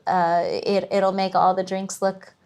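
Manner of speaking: ordinary talk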